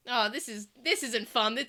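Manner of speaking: ordinary talk, nothing marked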